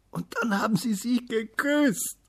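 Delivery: put-on high, loud, slow-drawn climax of a child's report; breathless excitement. A narrator's reading. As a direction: call it out as the thrilling finish. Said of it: "rief er am Schluss seines Berichtes ganz atemlos".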